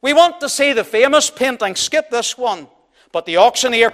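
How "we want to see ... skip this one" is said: loud, higher impatient heckler call; shouting from the back. A narrator's reading. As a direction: shout it across the room, demanding. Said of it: "a voice shouted from the back".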